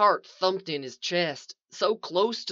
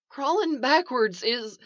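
higher, anxious protest cut short; 6 semitones higher than usual. A narrator's reading. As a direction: start explaining in rising fear. Trapped man protesting, cut off.

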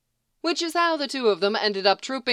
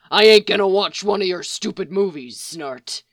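gruff Mick refusing; 5 dB louder than usual. A narrator's reading.

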